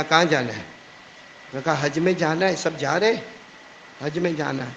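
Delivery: normal talking voice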